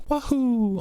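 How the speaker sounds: high, playful catchphrase imitation; Mario's little wahoo. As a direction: mimic a cartoon hero's high whoop. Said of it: "And also his little".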